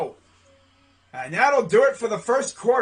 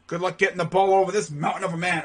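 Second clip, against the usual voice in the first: ordinary talk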